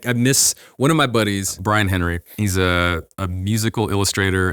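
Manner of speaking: ordinary talk, nothing marked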